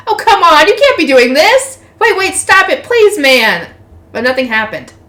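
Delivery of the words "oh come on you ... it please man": high, loud protesting plea; desperate crying out. A narrator's reading. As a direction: plead loudly as if begging someone to stop. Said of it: "while crying out things like".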